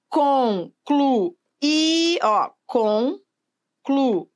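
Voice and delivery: concluirão drawn out slowly, stress on rão; syllable by syllable. Shown as a demonstration. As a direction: stretch concluirão slowly and land on the last syllable. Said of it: "não ficou bom". (separando concluirão devagar)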